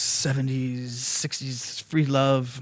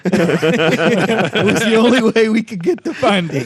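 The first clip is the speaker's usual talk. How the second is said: laughing; 6 semitones higher, 7 dB louder, slower than usual. Real, not acted. Joking about a naked movie.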